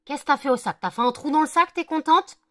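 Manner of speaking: loud, high scolding of the dog. Real, not acted. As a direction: tell the dog off sharply. Scolding dog for tearing bag.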